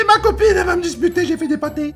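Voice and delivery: put-on high whiny voice, like a scolded kid; childish whine. Whiny repeat of the same worry.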